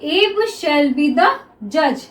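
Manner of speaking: very slow, each word held; slow dictation reading. Shown as a demonstration. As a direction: dictate slowly. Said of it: "next देखिए".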